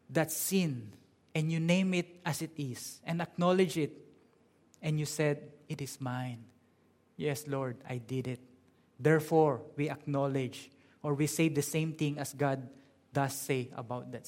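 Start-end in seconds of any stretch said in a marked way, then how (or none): 5.7-8.4 low, soft admission; subdued, plain-spoken confession; humble confession. Acted. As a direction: own up quietly to God. Acts out confessing to God.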